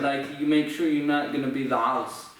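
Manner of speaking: ordinary talk, nothing marked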